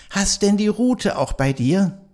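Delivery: put-on high, bright questioning child voice; Christ Child voice. Christ Child asking Knecht Ruprecht about the rod, high child voice. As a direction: ask sweetly and high like a little child.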